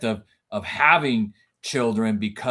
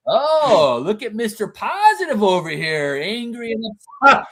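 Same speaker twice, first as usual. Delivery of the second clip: high mocking kid voice; teasing son; 10 semitones higher, 6 dB louder than usual. Oldest son mocking his angry dad, high and teasing. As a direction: tease dad like a cheeky teenager. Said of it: "my oldest son will always be like".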